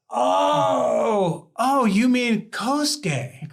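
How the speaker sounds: high, loud, drawn-out "oh" of recognition; dawning realisation. Retold or imitated. Sudden recognition of the name.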